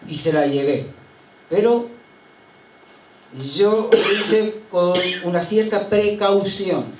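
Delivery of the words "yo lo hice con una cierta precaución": ordinary talk, nothing marked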